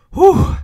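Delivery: big high exhale of relief; a sudden loud sigh of relief; loud relieved whew. Real, not acted. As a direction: blow out the tension, loud and high. Relief after a tense roll.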